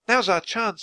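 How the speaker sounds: put-on high, eager girl's voice; excited urging. A narrator's reading. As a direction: urge them on, pitched high. Annie seizes chance to slip in.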